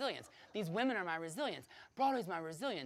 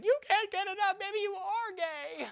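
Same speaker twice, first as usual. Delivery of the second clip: put-on much higher, slow, teasing girl voice; mocking girl voice; 10 dB louder than usual. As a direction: drag it out in a taunting girl's voice. [name] mocking him.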